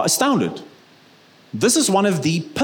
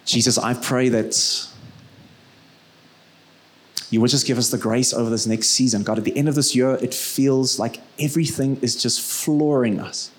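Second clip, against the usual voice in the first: closing prayer; 2 semitones lower than usual. Real, not acted. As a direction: pray gently over the congregation.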